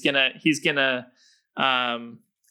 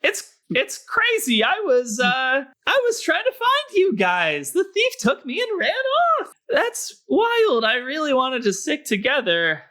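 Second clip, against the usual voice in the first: high, slightly whiny Carl; 11 semitones higher, 3 dB louder than usual. Acted. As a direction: complain in a high squeaky voice. Plays Carl.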